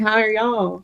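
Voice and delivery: southern accent. Shown as a demonstration. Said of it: "my southern accent saying".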